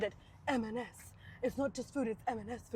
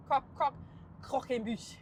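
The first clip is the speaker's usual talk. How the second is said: halting French-accented "croquembouche"; bad French accent; 3 dB quieter than usual. Shown as a demonstration. Trying the dessert's name, bad French accent. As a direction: attempt "croquembouche" in clumsy French. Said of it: "How bad French was that?".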